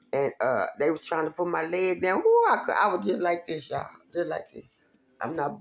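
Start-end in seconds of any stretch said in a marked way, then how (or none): 2.2-2.7 high sharp ooh, forced out in pain; pained yelp. Retold or imitated. Retelling the leg being forced down, a high pained ooh. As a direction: relive the jolt with a high yelp.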